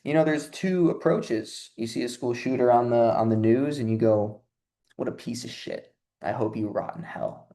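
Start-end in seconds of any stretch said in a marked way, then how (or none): 5.0-7.5 hushed disgusted mutter. Retold or imitated. Viewer reacting to shooter news.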